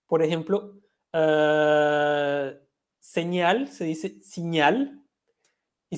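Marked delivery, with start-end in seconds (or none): 3.0-5.0 "señal" slowly stressed on the final syllable "-ñal"; slow teacher demo, stress on "señal"'s A. Shown as a demonstration. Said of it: "se acentúa en la A".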